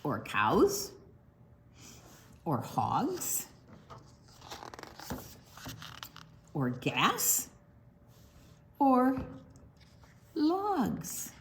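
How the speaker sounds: rhythmic list, rising on each item; reading to children. Acted. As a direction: build the rhyme item by item. Reads rhyming list aloud.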